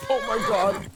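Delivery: high startled yelp; startled cry. Real, not acted. Horse stumbles through bush.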